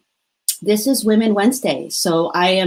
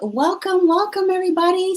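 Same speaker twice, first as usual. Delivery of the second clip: much higher, bright on-air welcome; excited host greeting; 12 semitones higher than usual. Real, not acted. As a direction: restart the show like the cameras just went on. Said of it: "we are live".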